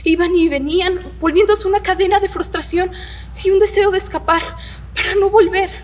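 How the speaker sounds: mounting distress. Acted. Girl reliving her wish to escape, increasingly desperate. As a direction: build from agitation to an anguished peak. Narrator relives panic and frustration.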